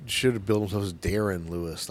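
ordinary talk, nothing marked

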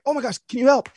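high-pitched, hurried cry for help; mock panicked plea. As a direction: imitate someone frantically begging God for help. Acts out hurried plea to God.

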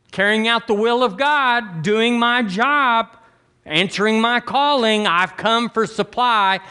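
march in claiming your due; preacher voicing a confident believer before God; bold request to God. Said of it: "We can't give him a real".

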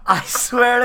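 high, loud laughter through the words; laughing. Real, not acted. Telling a friend the painful story, laughing hard. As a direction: crack up while swearing it's true.

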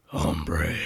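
low, breathy grizzled whisper. Pretend. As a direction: rasp it out in a whisper. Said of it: "Does everybody wanna try their grizzled voice?".

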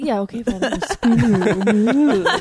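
loud, drawn-out words through laughter; laughing concession. Real, not acted. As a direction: draw it out slowly while laughing. Teased about spin room, gives in.

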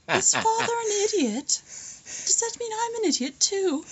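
put-on high small kid voice, asking innocently; child voice. Kids voiced asking about father.

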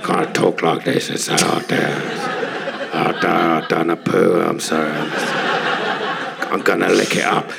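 low, goofy dog voice with laughs breaking through. Retold or imitated. Father doing the dog voice for his daughter's friends, low and goofy. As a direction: growl it out like a silly family dog. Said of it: "the voice of the dog".

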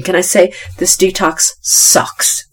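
normal talking voice

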